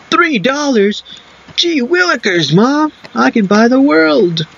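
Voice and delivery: put-on high, gee-whiz excited kid; wholesome 1950s kid. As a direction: sell it like an old-timey wholesome kid. Mock excited kid exclamation.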